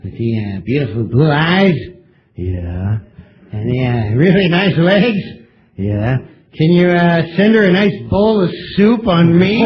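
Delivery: Norm Macdonald impression. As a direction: drop way down and deadpan it like Norm. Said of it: "It's a little Norm Macdonald for you".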